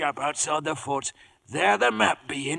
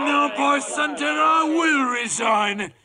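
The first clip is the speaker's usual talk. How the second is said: angry resignation; 7 semitones higher than usual. Acted. Pirate captain quits before crew.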